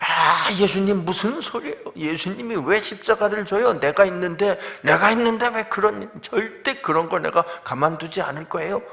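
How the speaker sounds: high, loud, indignant protest; blustering imitation of a scolding; brash big talk. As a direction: act out a cocky disciple telling off his master. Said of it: "큰 소리를 예수님 앞에 막 친 거죠".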